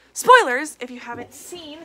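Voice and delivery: calling out a loud spoiler alert; loud warning. Real, not acted. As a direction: shout it out to warn viewers.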